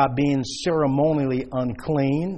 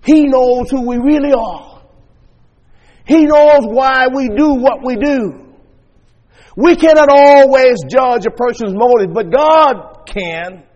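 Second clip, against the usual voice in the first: loud, raised pulpit proclamation; full-force preaching; 7 semitones higher, 10 dB louder than usual. Real, not acted. Preacher telling congregation that God knows motives, full-force preaching. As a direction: proclaim it hard, drawing out the final words.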